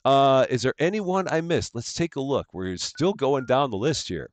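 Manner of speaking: high, sing-song hellos to each viewer; cheery stream greeting. Real, not acted. Streamer welcoming chat members by name, high and sing-song.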